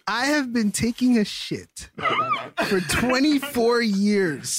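stretched out, very slowly; slow emphatic. Real, not acted. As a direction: drag each word out for emphasis. Defending himself, drawn out.

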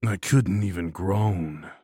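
usual speaking voice

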